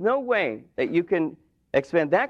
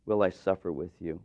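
weary Jesus; 9 semitones lower, 6 dB quieter than usual. Acted. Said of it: "And Jesus says".

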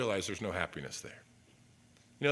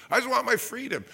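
mock plea; 10 semitones higher than usual. Sinner's plea voiced in sermon.

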